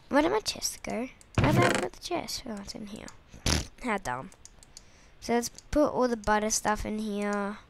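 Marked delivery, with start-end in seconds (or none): none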